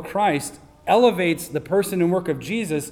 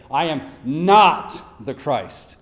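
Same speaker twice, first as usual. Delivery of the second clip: NOT slow and hard, voice louder on "I am not the Christ"; emphatic denial; 5 dB louder, slower than usual. Retold or imitated. John firmly denying to the leaders: emphatic, stressed not. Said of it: "it's an emphatic way".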